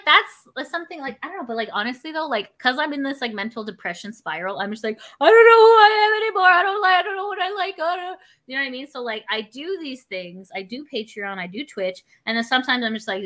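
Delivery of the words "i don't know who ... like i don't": high, louder mock-desperate cry; despairing wail. Lost in a depression spiral, high despairing wail. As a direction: wail about losing yourself.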